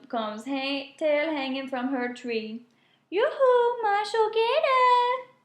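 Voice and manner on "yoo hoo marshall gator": high drawn-out sing-song call; calling out flirty. A narrator's reading. Possum teasing the gator from her branch, high sing-song call. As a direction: call to him from the tree, teasing. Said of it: "she calls as she swings".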